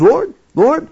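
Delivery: high pleading call to Jesus; a lightly voiced Peter, pleading; voicing Peter. Peter pleads with Jesus, a high, earnest cry. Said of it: "Peter comes to him and says".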